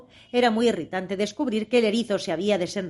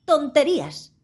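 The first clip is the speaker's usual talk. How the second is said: a firm, raised outburst from Alicia; loud and firm; 6 semitones higher, 4 dB louder, slower than usual. A narrator's reading. Alicia defies the Queen, loud and decided. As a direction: shout it with decision. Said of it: "exclamó Alicia en voz muy alta y decidida".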